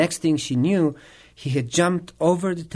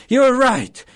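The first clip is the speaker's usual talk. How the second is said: much higher, loud burst; emphatic acted agreement; 11 semitones higher, 7 dB louder than usual. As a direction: exclaim it like a retold outburst. Acts the man's emphatic agreement.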